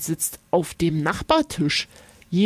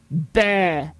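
grimacing bleh; 2 semitones lower than usual. A narrator's reading. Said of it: "Hotte zieht eine Grimasse und sagt".

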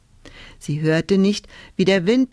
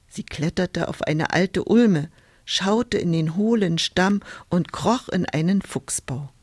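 ordinary delivery

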